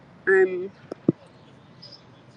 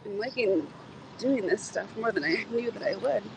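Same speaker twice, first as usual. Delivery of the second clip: normal talking voice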